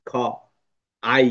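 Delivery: ordinary delivery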